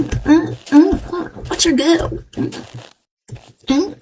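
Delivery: usual speaking voice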